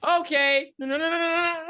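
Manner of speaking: held high, loud at the start; high, sustained comic objection; high-pitched protest. Real, not acted. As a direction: pitch it way up and reel off the refusals. Comic high protest after interruption.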